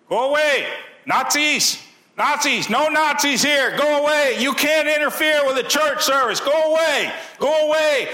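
very high, loud mock Russian shout: go away; a hammed-up Russian pastor yelling Nazis away; high loud Russian-accented shouting at police. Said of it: "he had that Russian accent".

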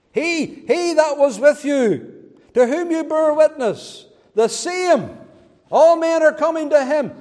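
high, loud insinuating quote; sneering accusers. Retold or imitated. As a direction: needle him with each phrase, cutting. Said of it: "They don't name him".

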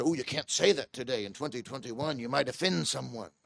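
soft, low mocking quote; hushed imitation of a timid objector; mock objection. Preacher mimicking people who fear giving offence, soft and low.

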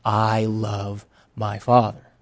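soft, slow and low; a listener speaking fondly of their father; tender. Pretend. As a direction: say it with quiet devotion. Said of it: "you will probably say the same".

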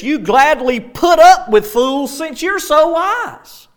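high-pitched, emphatic sarcastic praise; lightly mock them with exaggerated emphasis; Paul's sarcasm. Paul chiding Corinthians who tolerate fools, lightly sarcastic. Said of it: "the sarcasm that Paul can use".

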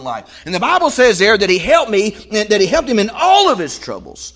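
fast, loud, high sermon rush; preaching at full force. Acted. Preacher urging the congregation, loud and high. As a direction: drive it home with fire.

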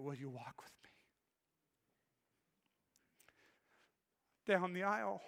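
hushed, voice catching on the words; reading the engraving through tears, soft; choked up. Real, not acted. Pastor reading his daughter's tie pin inscription, choked and soft. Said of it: "I was done".

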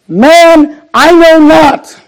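loud, high-pitched protest, words drawn out; emphatic shouted denial in a Bible reading. Acted. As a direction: cry out the denial at full voice. Reading Peter's denial aloud.

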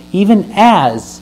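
"as" drawn out slowly and leaned on; slow down and land hard on "as"; stress on AS. Shown as a demonstration. Preacher reading Ephesians to the church, leaning slowly on "as". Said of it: "I like to stress that word".

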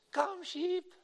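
very high, imitated small child's call; squeaky little-girl yell. Five-year-old daughter calling the pastor's sheep, squeaky yell. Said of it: "yelled up that hillside with her squeaky voice".

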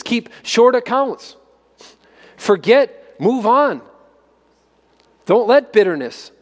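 fervent rising preaching; preaching at full force. Acted. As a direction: press each command on the congregation. Preaching builds to exhortation.

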